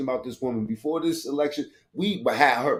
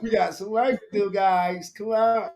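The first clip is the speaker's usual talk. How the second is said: mock chirpy rally line; 6 semitones higher than usual. Echoes clip line.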